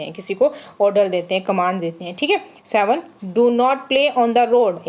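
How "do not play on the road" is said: slow, spelled-out example sentence; slow and clear for the class; slow read-aloud. Shown as a demonstration. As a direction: read the order slowly and clearly. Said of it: "एकदम से order दिया ना आपको".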